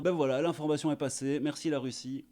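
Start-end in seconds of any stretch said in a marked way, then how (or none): none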